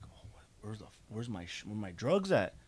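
soft, half-voiced muttering while hunting for something; hushed and puzzled, under his breath; hushed search. A boy searching his room for his missing stash, hushed and puzzled.

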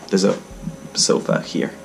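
normal talking voice

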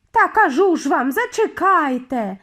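high, loud, exasperated call; shouting. A narrator's reading. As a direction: shout insistently to make them wait. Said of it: "крикнула Мілдред".

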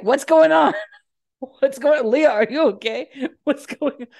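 high, loud, urgent questions; retold operator shouting down the line; frantic operator. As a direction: call out to the silent caller in alarm. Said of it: "Jordan's like".

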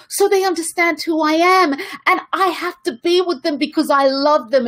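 high-pitched insistent lover's argument; pleading protest. Acted. Smitten lover argues with their own intuition, pleading protest.

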